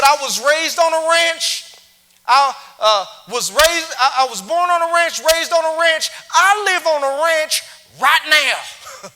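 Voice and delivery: high, loud proud cowboy boast; proud rancher. Retold or imitated. Texan rancher boasting to the speaker, high and loud. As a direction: boast about the ranch life loudly. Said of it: "awesome Texan rich accent that I wish I could capture".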